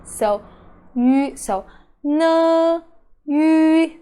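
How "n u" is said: N and U sounded out singly, higher; spelling out sounds. Shown as a demonstration. Teacher building the word Nu letter by letter. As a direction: say N, then U, as separate sounds.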